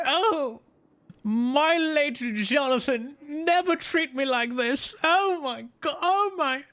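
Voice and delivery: put-on high, drawn-out British old lady; hammed-up British widow in raptures, very high; British granny. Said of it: "she British".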